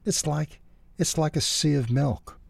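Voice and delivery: high, halting, wondering answer; slow reply. A narrator's reading. Martinez describes the snow to Ralph, slowly and haltingly. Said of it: "Martinez replied slowly".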